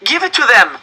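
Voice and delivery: loud, high desperate yell; boy yelling at his family, loud. Acted. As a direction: shout at the adults to hand it over. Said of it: "I yelled".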